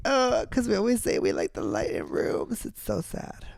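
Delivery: high, soft, near-crying voice; tearful. Real, not acted. Friend moved by a sad song, tearful. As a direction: choke up in a small high voice. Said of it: "See, I'm already crying".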